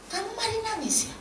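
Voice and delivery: high-pitched imitation of a curious boss; boss's probing question. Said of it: "Bos ditanya".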